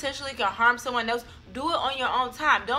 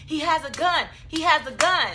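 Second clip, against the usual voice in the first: imitating a panicked yell, pitched high; mock scream, urgent but held back; retold scream; 5 semitones higher, 4 dB louder than usual. Retelling her brother screaming behind her, raised-voice imitation. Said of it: "my youngest brother behind me screaming".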